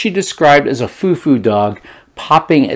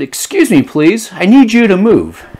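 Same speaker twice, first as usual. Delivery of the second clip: [name]'s polite request; 8 semitones higher than usual. Retold or imitated. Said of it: "I said".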